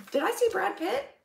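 ordinary talk, nothing marked

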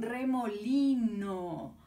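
remolino stretched slowly; teacher shows the picture of a remolino to children, slowly; slow word. Shown as a demonstration. Remolino drawn out. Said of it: "En qué termina".

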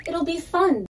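high sarcastic sing-song; mock quote. Mocking those who promised fun, high sarcastic tone. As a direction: parrot the empty promise. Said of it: "they said".

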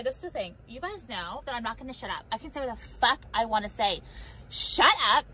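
ordinary talk, nothing marked

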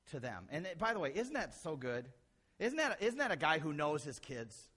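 usual speaking voice

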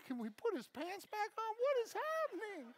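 climbing into a high, thin whine; squeaky comic distress; overwhelmed. Retold or imitated. Overwhelmed rescuer, inner panic.

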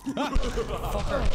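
laughing out a loud curse. Real, not acted. Streamer laughs after stealing from game characters: loud laughing curse. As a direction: crack up and swear.

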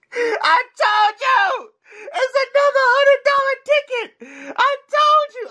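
very high, elated shouting; triumphant squeal. Real, not acted. Scratcher proved right about the win, triumphant squeal. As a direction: crow it out in a high, thrilled squeal. Celebrates the called winning ticket.

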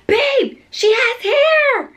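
very high, loud squeal of discovery; amazed shriek. Retold or imitated. Mom spots baby's hair in mirror, amazed shriek. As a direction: stretch it into a thrilled squeal.

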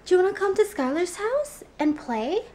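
put-on high, eager little girl voice. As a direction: sweetly invite like a small child. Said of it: "all of a sudden she goes".

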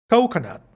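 "Coconut" with a high, stressed COH; clear O. Shown as a demonstration. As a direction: punch the "ou" opening of "Coconut". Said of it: "es importante aplicar el O". ("Coconut" con la primera O alta y marcada)

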